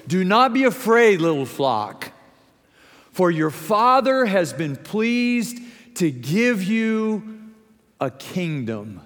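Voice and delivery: high, emphatic Bible reading, settling at the end; scripture proclamation. Acted. Preacher reading Luke to congregation, emphatic proclamation.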